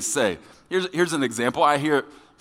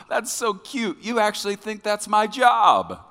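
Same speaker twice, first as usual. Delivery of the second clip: condescending; 7 semitones higher than usual. Retold or imitated. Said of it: "Let me give you the translation".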